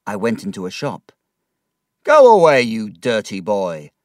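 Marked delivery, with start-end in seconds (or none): 2.0-3.9 shopkeeper shouting. A narrator's reading. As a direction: bark it out of the shop. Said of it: "the shopkeeper shouted".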